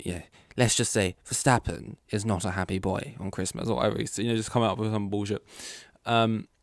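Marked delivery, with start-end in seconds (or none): 0.6-3.7 mock pundit voice delivering the punchline; smug soundbite, put on for laughs; Will Buxton impression. Vlogger mimicking Will Buxton's Christmas soundbite, mock pundit voice. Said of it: "Will Buxton dropping his sound bites".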